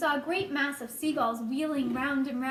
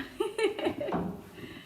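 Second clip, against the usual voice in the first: breathy laugh; 8 semitones higher, 4 dB quieter than usual. Real, not acted. Narrator amused by own remark.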